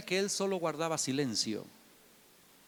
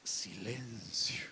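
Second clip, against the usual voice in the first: whisper; 8 semitones lower, 11 dB quieter, much slower than usual. Real, not acted. Dramatic pause word whispered.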